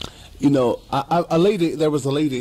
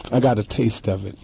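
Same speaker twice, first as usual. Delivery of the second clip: normal talking voice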